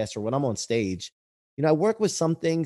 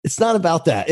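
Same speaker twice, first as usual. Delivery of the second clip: higher, louder protest; mock-indignant retort; 9 semitones higher, 4 dB louder than usual. Husband retelling his answer to his wife, mock-indignant retort. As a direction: push back with raised pitch and volume.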